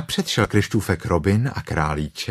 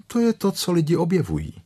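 offhand; 10 semitones higher, faster than usual. Acted. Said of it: "řekl Krištůfek Robin ledabyle".

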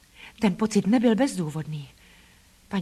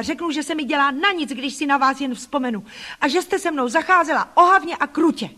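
put-on high, raised girl's voice, spitting accusations; furious accusation; 6 semitones higher than usual. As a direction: pour out years of hurt at her aunt. Child accuses her aunt.

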